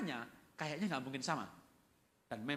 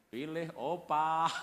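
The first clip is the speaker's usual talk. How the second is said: put-on child's comic answer; 4 dB louder, much slower than usual.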